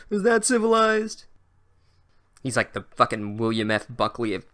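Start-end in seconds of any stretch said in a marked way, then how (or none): 0.1-1.2 slow, high, snooty aristocrat voice; arrogant pixie. Retold or imitated. Brainy pixie scolding the class, sophisticated and arrogant. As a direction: look down your nose and drawl it. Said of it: "said with, like, a sophisticated arrogance".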